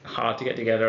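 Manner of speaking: normal talking voice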